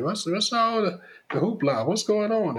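grandma wandering in, mildly curious; a hint of the grandmother. Grandmother walking in on the fuss, lightly voiced and puzzled. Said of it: "She comes in and".

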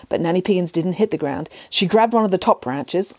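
plain reading voice

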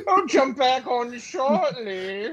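high, slow mock villain voice; evil genius imitation. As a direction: mimic a hammy sixties mastermind. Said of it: "a maniacal evil genius".

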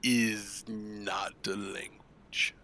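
very slow, hushed shark voice; slow shark character. Acted. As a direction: draw each word out softly. Slow in-character reply as shark Halp.

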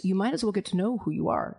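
ordinary delivery

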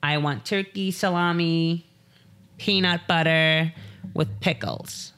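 mock drunk-girl drawl. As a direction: order like a wasted Valley girl.

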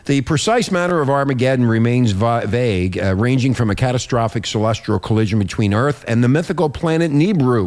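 radio report read aloud; news read. Acted. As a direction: read it out like a newscaster. Host reads a news report.

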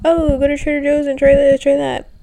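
hammed-up excited recommendation; mock gushing friends. Friends pushing Trader Joe's picks, mock gushing. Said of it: "but everyone's like".

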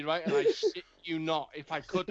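normal talking voice

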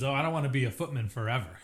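ordinary talk

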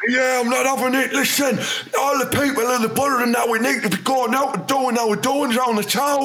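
high squeaky rant mocking a blustering mayor; mayor of Borough impression. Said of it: "That's how he".

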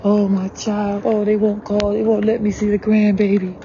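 hammed-up whining over the grandbaby; mock crying parent. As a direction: mimic a parent's self-pitying wail.

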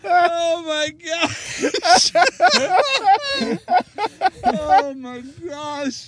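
high, loud, drawn-out laughing cry; shrieking laughter. Real, not acted. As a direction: crack up in shocked disbelief.

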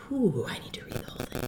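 whisper. Retold or imitated. Retold reaction to an interview.

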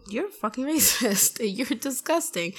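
appalled, with drawn-out final words; disgusted. Retold or imitated. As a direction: imitate telling someone off in disgust. Retold quarrel with ex.

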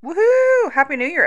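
high, loud whoop; excited cheer. Real, not acted. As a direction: cheer it out big. Cheering the new year.

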